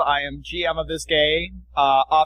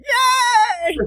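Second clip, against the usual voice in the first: high, loud squeal of joy; mock excited; 5 dB louder than usual. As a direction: squeal it like an eager fan. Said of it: "the only one that's excited".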